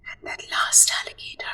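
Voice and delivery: whispered build-up; suspense whisper. A narrator's reading. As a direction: lean in and whisper.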